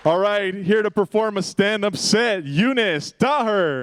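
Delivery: high, hyped emcee welcome; show host intro. Acted. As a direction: bring the comic on with energy.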